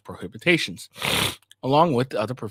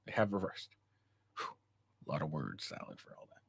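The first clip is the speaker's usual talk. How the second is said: quiet aside; 7 semitones lower, 13 dB quieter than usual. Real, not acted. Muttered aside after long reading.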